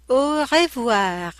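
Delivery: "au revoir" slow and careful; slow textbook pronunciation. Shown as a demonstration. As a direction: model "au revoir" slowly for a class.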